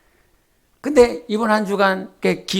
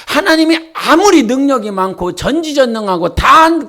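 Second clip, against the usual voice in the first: ordinary talk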